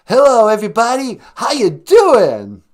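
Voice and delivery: high, very loud rock frontman greeting. Acted. Rock singer greeting the crowd, high shouted stage voice. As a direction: holler to the arena, stretching the last word. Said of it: "[name], ladies and gentlemen".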